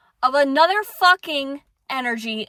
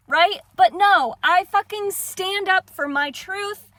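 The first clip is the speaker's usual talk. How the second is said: heated shouting; 5 semitones higher, 3 dB louder than usual. Real, not acted. Insists it's truth, not anger.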